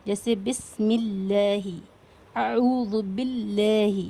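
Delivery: بسم اللہ and اعوذ باللہ with a thin, light ل, slowly; light Allah. Shown as a demonstration. Teacher demonstrates the light lam after zer, slowly. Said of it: "تو باریک پڑھیں".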